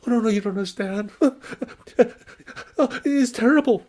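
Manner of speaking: high, loud stammering terror; panicked stammer. Acted. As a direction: stumble over the words in fright. Panicked merchant.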